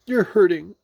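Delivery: high, loud complaint; crying out. A narrator's reading. Pooh being pulled by Rabbit, crying out. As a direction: complain loudly in storybook pain. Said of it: "cried Pooh".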